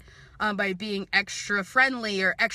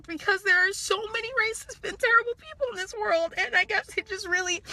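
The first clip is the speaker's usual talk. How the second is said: tearful, pitch climbing high; crying; 9 semitones higher than usual. Real, not acted. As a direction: let the tears lift the voice. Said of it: "I'm really sorry that I'm crying".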